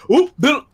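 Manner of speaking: high-pitched "oop, boop" effect; little high cartoon pop noises; imitated cartoon blips. Describing a cartoony death scene, imitated cartoon blips. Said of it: "the silhouette's like".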